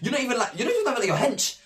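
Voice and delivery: high, fast, squeaky disbelief. Retold or imitated. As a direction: sound shocked at being shoved back. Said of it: "I'm thinking".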